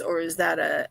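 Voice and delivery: ordinary talk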